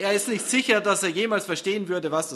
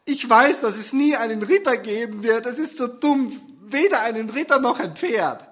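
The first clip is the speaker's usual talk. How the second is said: higher, louder teary wail; tearful lament; 7 semitones higher, 8 dB louder than usual. Acted. Susanne mourning the knight who never comes, higher teary wail. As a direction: cry out about the knight.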